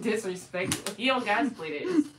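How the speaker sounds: usual speaking voice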